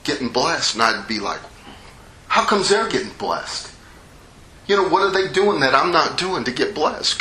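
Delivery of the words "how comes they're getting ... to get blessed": higher-pitched, put-out protest; indignant complaint. Retold or imitated. Believer sees others getting blessed, mock indignant. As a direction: imitate your own resentful grumbling. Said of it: "He gets mad. And this is where I was at".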